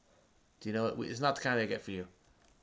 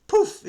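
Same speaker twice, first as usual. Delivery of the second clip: poof; 9 dB louder than usual. Pretend. Sound effect for disappearing.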